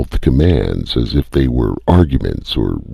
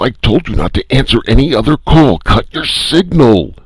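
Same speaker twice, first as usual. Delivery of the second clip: shrill, much higher angry yell; strident; 12 semitones higher than usual. A narrator's reading. First voice ordering Calhoun off the air, strident yell. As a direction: scream the order, furious. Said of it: "interrupted stridently".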